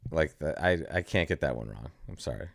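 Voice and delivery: ordinary delivery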